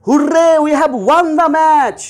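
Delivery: high, loud Hurray, delighted; joyful cheer. Shown as a demonstration. Teacher showing students how joy sounds, high joyful cheer. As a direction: cheer the win with real joy. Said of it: "আমার খুব আনন্দ হচ্ছে".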